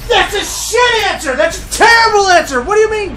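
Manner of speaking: very high, hammed-up outrage; outraged shrieking. Caller rejecting the useless answer, high comic outrage. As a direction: screech in comic disbelief.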